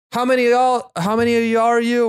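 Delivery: high, loud mock hostess voice; bored hostess. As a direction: put on a high-pitched voice for the hostess. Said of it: "bored hostess who just looks at us".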